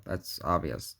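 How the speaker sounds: usual speaking voice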